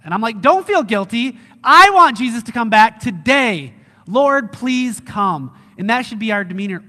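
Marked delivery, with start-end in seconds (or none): 0.4-5.5 loud, higher, emphatic longing; fervent urging. Acted. Pastor answering guilty Christians, fervently eager. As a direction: declare it boldly, ending in a drawn-out plea.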